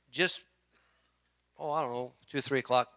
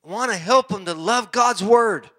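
mock eager plea; 6 semitones higher, 9 dB louder than usual. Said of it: "He was just like".

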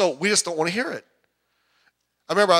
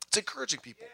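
much softer, hushed aside; hushed repeat; 3 semitones higher, 11 dB quieter, faster than usual. Real, not acted. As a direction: drop the volume right down to underline the point.

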